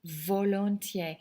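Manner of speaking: volontiers said slowly and clearly; slow repeat of volontiers; slow model word. Shown as a demonstration. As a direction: stretch out volontiers for the class. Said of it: "you could say it is".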